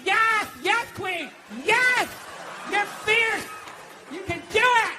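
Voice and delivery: high, hyped-up mock encouragement; gushing cheerleading. Lesbians supporting any idea, gushing imitated cheer. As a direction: hype them up like a supportive friend. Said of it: "the most positive people".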